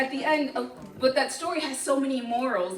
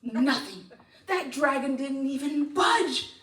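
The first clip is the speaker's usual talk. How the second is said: plain reading voice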